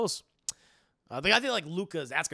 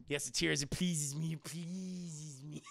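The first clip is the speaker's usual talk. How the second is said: gloating villain; 7 dB quieter, slower than usual. Acted. Host mock-gloating over viewers' tears, hushed villain voice. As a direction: savor their tears like a villain.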